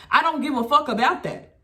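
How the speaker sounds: normal talking voice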